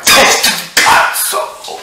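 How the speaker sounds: high, loud, slow strained curse; strained involuntary curse; forced outburst. Pretend. Mock tic outbursts of swearing.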